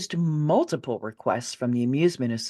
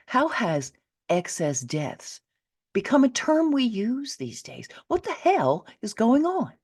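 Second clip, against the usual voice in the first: indignant outburst; 8 semitones higher than usual. Real, not acted. Outraged rhetorical questions.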